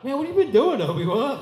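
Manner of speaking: excited. Retold or imitated. Voicing a Star Wars character.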